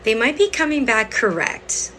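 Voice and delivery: ordinary talk, nothing marked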